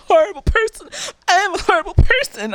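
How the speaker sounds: high, fast, whiny mock wail; mocking whiny self-pity. Mocking a sad ex's self-pity in a whiny wail. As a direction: parody his overdone self-pity. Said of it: "be like".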